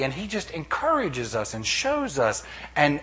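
ordinary talk

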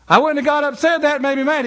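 high, loud insistent repeat. Retold or imitated. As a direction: raise your voice so he hears. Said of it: "we begin to raise our voice".

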